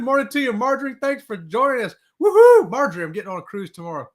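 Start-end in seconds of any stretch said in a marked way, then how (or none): 2.2-2.7 loud, high whoop; woohoo cheer. Real, not acted. Host cheering a viewer's greeting, loud high whoop. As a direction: let out a big celebratory whoop.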